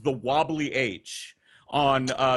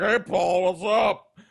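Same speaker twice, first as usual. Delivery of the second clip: imitating drunk greeting; 3 semitones higher, 5 dB louder, slower than usual. Drunk roommates passing Paul in the living room, mock loud holler. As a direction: call out like a rowdy drunk.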